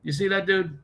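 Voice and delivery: high-pitched put-on voice; mock onlooker. Streamer mimicking people reacting to the news, high mock voice. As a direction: pitch it way up, imitating someone reacting.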